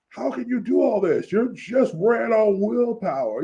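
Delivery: high-pitched, baffled villain's protest; lightly acted incredulous question; mock disbelief. Fan voices stunned villain questioning the hero, incredulous.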